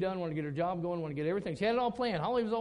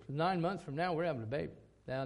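ordinary delivery

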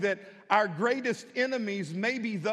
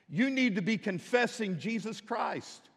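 normal talking voice

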